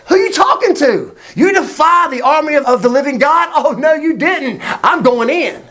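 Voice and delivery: high, sassy indignation; hammed-up outraged young fighter; fired-up challenge. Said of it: "David gets fired up".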